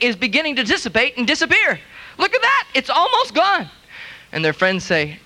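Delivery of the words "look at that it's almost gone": much higher, thrilled pointing at the leg; amazed show-and-tell. Retold or imitated. Healed believer showing friends his leg, thrilled and amazed.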